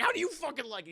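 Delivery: high-pitched vengeful outburst; mock angry retort. Burglar hitting his partner back, mock angry retort. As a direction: act out getting even.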